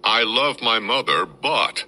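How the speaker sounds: a touch of a sneering officer; read aloud mockingly. A narrator's reading. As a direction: hold up the paper and read it pointedly. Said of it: "held up a sheet of paper and read aloud".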